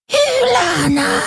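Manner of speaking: a desperate yell; desperate shout. A narrator's reading. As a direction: cry her name out in alarm. Urgent cry across the scene.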